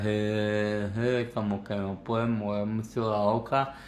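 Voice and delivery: slurred, mouth barely moving; numb-mouthed dental anaesthesia. Pretend. Teacher imitating someone fresh from the dentist, numb-mouthed slur. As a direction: mumble as if the lips were numb from the dentist. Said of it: "les hubieran puesto una anestesia".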